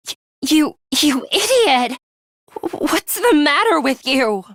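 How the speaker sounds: high, stammered angry scolding; exasperated outburst. Acted. Scolding a reckless teammate, exasperated high outburst. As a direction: snap at him for being so reckless.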